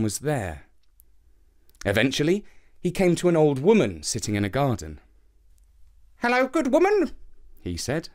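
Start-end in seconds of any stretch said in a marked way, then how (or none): 6.2-7.1 put-on higher, polite young boy; boy's voice. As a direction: greet her as a polite boy. Boy greets old woman.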